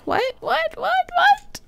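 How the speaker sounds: squeaky mounting disbelief; rising disbelief. Real, not acted. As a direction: climb the pitch with every repeat. Repeated what climbing ever higher.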